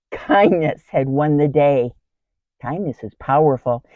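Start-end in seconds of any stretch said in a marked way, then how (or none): none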